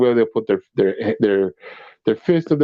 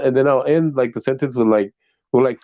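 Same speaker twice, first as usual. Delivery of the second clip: normal talking voice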